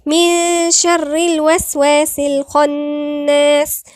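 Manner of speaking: slow, loud Quranic recitation; recitation with heavy kha. Shown as a demonstration. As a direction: hum the ن of من, make the خ in الخناس full and heavy. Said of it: "حرف غنة مشدد".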